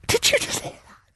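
stunned whisper to a friend; shocked whispered aside. Retold or imitated. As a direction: breathe it out in disbelief.

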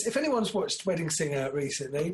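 normal talking voice

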